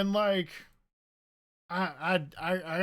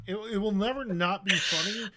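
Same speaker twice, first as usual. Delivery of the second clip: ordinary delivery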